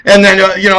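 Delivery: normal talking voice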